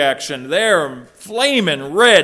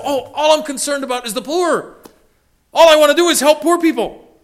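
higher, put-on pious whine; mock piety; 9 semitones higher, 3 dB louder than usual. Preacher mocking disciples' pretended care for the poor, high and hammed-up. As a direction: ham up fake righteous concern.

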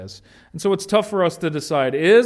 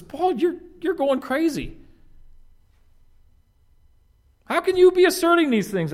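incredulous outburst; 13 semitones higher than usual. Retold or imitated. Festus's outburst retold.

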